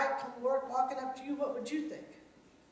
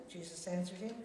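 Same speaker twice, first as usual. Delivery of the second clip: usual speaking voice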